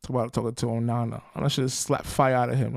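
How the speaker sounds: ordinary delivery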